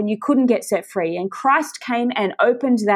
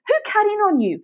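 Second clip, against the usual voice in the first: "Who" pitched much higher, asked straight at the listener; pointed higher question; 9 semitones higher than usual. Shown as a demonstration. Preacher confronting TYB directly, pitched up and urgent. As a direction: lift "Who" high and press the question home. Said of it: "Can I say that to you".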